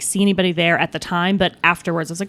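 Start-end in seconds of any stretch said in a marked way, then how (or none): none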